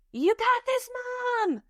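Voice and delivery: high, put-on child's voice calling up; daughter cheering. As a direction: imitate a girl cheering on her mom from below. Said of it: "I hear from right below me, my daughter".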